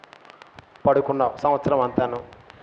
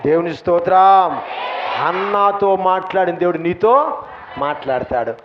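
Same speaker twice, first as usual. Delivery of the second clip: ordinary talk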